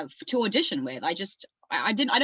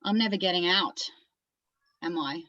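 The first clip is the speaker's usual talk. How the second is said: lightly played Australian monologue character. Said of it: "it's for an Australian character".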